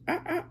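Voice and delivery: very high monkey squeaks; two short squeals like a monkey; monkey noises. Acted. Parent showing what the monkey goes, monkey noises. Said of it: "Monkey goes".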